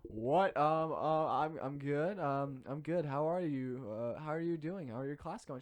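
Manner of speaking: awkward confused reply. Retold or imitated. Cornered by a stranger who knows him, awkward stammering. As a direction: stammer politely, not knowing her. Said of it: "I'm like".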